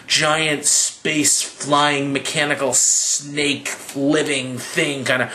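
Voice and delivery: usual speaking voice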